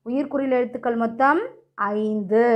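ordinary talk

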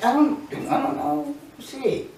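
hushed, barely voiced mumble; whispering. Real, not acted. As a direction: keep it down to a whisper. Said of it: "why are you whispering over there?".

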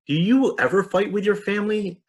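put-on high child's question; curious child. Child Salem asks.

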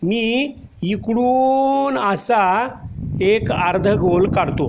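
very slow, drawn-out, step by step; slow demonstration. Shown as a demonstration. Teacher to children showing how to draw, slowly. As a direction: guide the children slowly through drawing the letter. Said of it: "सावकाश काढायचं".

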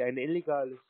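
normal talking voice

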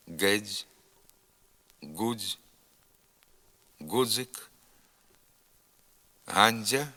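fused voiced affricate "дз" in each word, like a voiced ц; дз as one sound. Shown as a demonstration. Teacher gives words for students to repeat, дз fused as one sound. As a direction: say "дз" in "дзвін", "гудзик" as a single voiced sound. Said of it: "вимовляється один звук дз".

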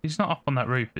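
ordinary talk

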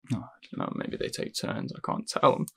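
unsure whisper. Acted. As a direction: breathe it out, barely voiced.

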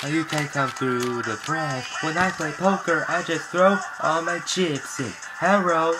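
ordinary delivery

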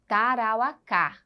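"Tarauacá" read slowly in separated syllables. Shown as a demonstration. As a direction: stretch out "Tarauacá" for pupils, ending on stressed "cá". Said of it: "Leiam aí". ("Tarauacá" devagar, sílaba por sílaba, até o "cá" tônico)

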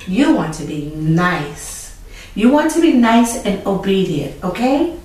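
put-on loud, firm moral talk to children; preaching lesson. Teacher preaching obedience to children after the story. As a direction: lecture the kids with conviction.

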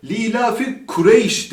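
"Kureyş" with a front Turkish k and e; mistaken Kureyş. Shown as a demonstration. Quran teacher showing students a common error, Turkish-style sounds. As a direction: say "Kureyş" as an everyday Turkish word. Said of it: "Diğer hata da arkadaşlar".

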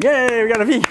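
playful heavenly whoop; mock angel cheer. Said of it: "all the angels in heaven start cheering".

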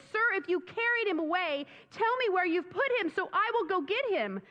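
high, pleading and distraught; distraught plea. Acted. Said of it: "she is so distraught".

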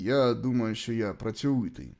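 ordinary talk, nothing marked

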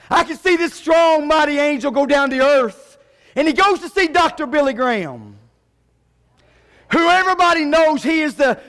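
rousing sermon climax; fired-up preaching. Real, not acted. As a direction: preach it out to the whole congregation. Preaches at full force.

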